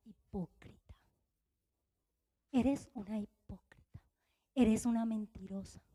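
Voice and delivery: low, near-whispered reproach; hushed accusation. Retold or imitated. The Spirit to her at the mirror, hushed accusation. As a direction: whisper the charge, then keep it soft and low.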